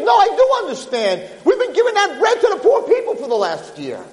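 high-pitched, exasperated correction; angry retort. Acted. Rabbi tells peasant who really got the bread, angry retort. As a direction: set him straight indignantly.

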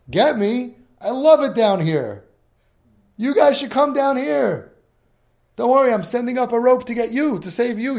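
high, loud holler from far below; screaming up. Acted. Fallen man and rescuer shouting across the pit, high hollering. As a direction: yell up out of a pit, stretched out. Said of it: "And he screams up".

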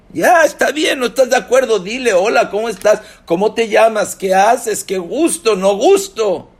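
high, loud rattled-off greetings; exasperated outburst. Acted. Preacher acting out an answer to someone who stays silent, exasperated shout. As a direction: fire off small talk in mock exasperation. Acted retort about keeping silent.

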